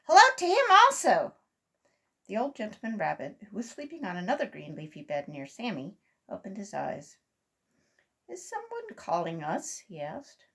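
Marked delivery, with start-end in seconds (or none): 0.1-1.3 high, loud shouted greeting; shouting. A narrator's reading. Second voice greets Uncle Wiggily, shouting. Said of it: "shouted a second voice". 8.3-9.8 higher, slow question; puzzled waking question. A narrator's reading. Old gentleman rabbit, just woken, asks, puzzled.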